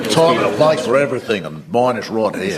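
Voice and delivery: put-on broad Australian accent. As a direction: put on an Aussie accent for a mock quote. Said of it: "That's a good accent".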